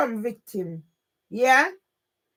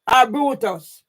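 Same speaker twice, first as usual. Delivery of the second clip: ordinary talk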